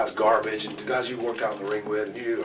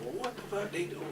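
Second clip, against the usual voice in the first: retold disbelief; 8 semitones higher, 3 dB quieter than usual. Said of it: "I thought".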